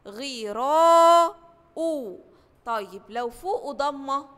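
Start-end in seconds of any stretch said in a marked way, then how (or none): 0.1-1.4 غراء very slow and louder; model word. Shown as a demonstration. Teacher to first graders, loud stretched model word. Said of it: "زي كلمة".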